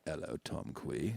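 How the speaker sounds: slow, low, very soft schoolmaster; sinister professor, low and drawn out; Professor Snape. Acted. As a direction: greet him slowly in a hushed, low voice. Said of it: "Professor Snape".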